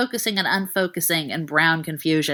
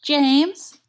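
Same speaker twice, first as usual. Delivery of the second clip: muffled shaky voice; 7 semitones higher, 3 dB louder than usual. Acted. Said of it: "voice muffled, wet, a little shaky".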